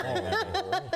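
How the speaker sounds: high, loud, laughing Marlboro in a put-on accent; mock northern accent. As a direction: ham up her northern vowels. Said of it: "without my northern accent".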